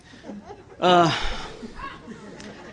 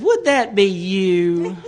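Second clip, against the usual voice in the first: very drawn-out, doubting question; imitating a dubious friend, slow; retold skeptical question; much slower than usual. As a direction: stretch it out slowly, mock-skeptical.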